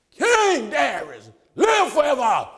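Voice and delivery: slow, loud, high proclamation; triumphant greeting. Retold or imitated. Daniel calling up to King Darius from the den, joyful shout. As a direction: ring it out from the lion's den.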